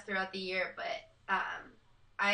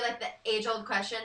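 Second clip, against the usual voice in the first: ordinary delivery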